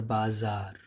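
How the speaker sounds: बाजार with a buzzed Z, "baa-zaar"; careful Z in the middle of बाजार; dotted Z sound. Shown as a demonstration. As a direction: draw out बाजार with Z as in zoo. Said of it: "उसकी जो pronunciation है".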